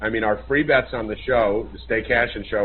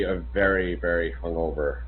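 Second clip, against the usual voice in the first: ordinary delivery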